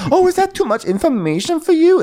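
very high, put-on indignant squeal; mock taunt. [name] goading Sutton, shrieky mock taunt. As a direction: jeer it in a hammed-up imitation.

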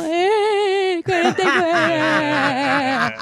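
put-on slow, unsure kid voice stretching the number; hesitant child answer. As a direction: drag out the answer like a scared child. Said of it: "Y uno".

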